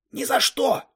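put-on high, emphatic boy's refusal. A narrator's reading. One boy rejects his partner's idea of reinforcements, emphatic. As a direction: refuse outright, pitch leaping up.

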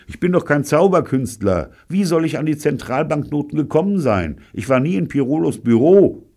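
high, urgent self-defence; loud protest. A narrator's reading. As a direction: protest your innocence over the blows. Protesting while being beaten.

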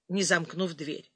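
ordinary delivery

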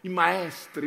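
ordinary talk, nothing marked

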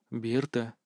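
very soft, low murmur; murmured in her ear. A narrator's reading. Kris holds the struggling Berta and speaks in her ear, hushed. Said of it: "шепнул на ушко".

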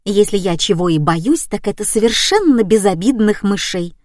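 ordinary delivery

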